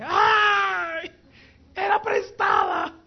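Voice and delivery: loud, very high drawn-out wail; acted anguished cry. Borrower crying out over a lost borrowed thing, hammed-up wail. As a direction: yell it out in hammed-up despair. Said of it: "Quiero escucharte".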